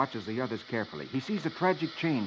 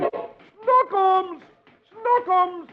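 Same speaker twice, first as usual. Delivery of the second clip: much higher, loud desperate calling; frantic yelling; 5 dB louder, much slower than usual. Acted. Archie yells for his lost baby, high and desperate. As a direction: shout down the street for the lost baby. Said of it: "running off down the street yelling".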